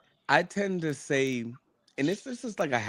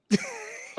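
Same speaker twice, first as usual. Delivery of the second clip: high breathy laugh. Real, not acted. Laughing at bumped head joke.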